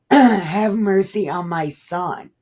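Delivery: pleading. Acted. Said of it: "He said".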